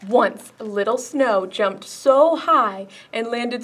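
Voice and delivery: slow, gentle storytime read; story reading. A narrator's reading. Librarian reading a picture book to kids, gently. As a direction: read it out to little ones.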